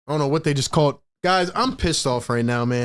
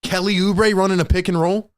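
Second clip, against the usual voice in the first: ordinary talk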